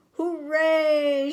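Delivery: high, loud cheer; joyful shout. A narrator's reading. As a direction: cheer together with all your might. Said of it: "shouted Little Beaver and the duck".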